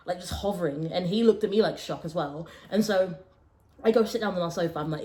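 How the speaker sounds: ordinary talk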